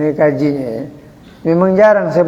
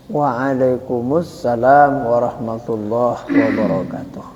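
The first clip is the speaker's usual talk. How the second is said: lower, softer, slow full reply; calm full reply; 6 semitones lower, 5 dB quieter, slower than usual. Shown as a demonstration. Preacher models the proper full reply to a salam. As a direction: answer the greeting calmly and in full. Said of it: "ucapkan salam pada guru".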